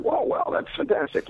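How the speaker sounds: pleasantly surprised. Retold or imitated. Teacher retelling his reply to the kid's book choice, pleasantly surprised. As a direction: react with high-pitched, impressed approval.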